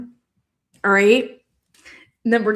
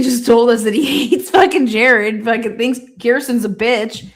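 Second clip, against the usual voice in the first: ordinary delivery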